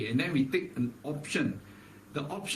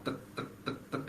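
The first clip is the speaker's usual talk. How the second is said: roller coaster clicks; 9 dB quieter, faster than usual. Retold or imitated. Roller coaster slowly climbing.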